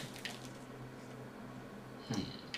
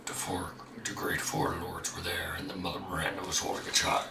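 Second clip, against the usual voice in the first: a lightly lowered voice reading a diary; deepest voice; 3 semitones lower than usual. Pretend. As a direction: read the notes in your deepest voice. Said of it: "deepest voice you can".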